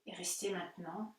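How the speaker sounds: calm, unhurried guiding voice with long pauses; guided meditation. Acted. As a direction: gently lead them inward into stillness. Leads guided Yoga Nidra relaxation.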